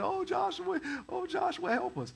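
high, whiny mock plea; mock whining. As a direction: whine it like a crybaby. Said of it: "they're whining and complaining".

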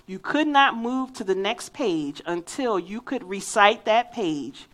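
ordinary talk, nothing marked

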